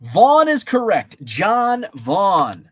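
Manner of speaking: loud, high call of Vaughn; excited announcement. Real, not acted. Host to viewers, revealing the answer, excited. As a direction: announce it with a burst.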